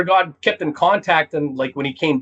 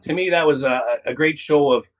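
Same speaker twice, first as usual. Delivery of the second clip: ordinary delivery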